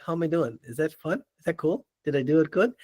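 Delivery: soft, sly check-in, imitated; conspiratorial aside. Ranting boss glancing at his right-hand man, hushed playful aside.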